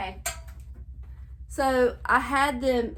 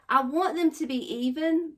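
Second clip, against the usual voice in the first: usual speaking voice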